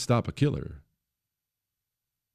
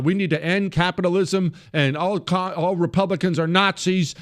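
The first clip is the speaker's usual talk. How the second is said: mock proclamation; 6 semitones higher than usual. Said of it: "she will get up and loudly proclaim".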